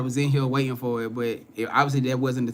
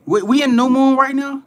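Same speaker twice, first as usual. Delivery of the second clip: sudden high, loud disbelief; surprised; 10 semitones higher, 7 dB louder than usual. Real, not acted. Streamer surprised by chat's new moon news, high and loud. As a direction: caught off guard, pitch jumps up.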